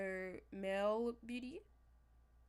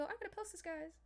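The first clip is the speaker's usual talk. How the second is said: higher, lightly sing-song; mock eager promise; 8 semitones higher, 5 dB quieter than usual. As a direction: tease your own empty promise. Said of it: "I go".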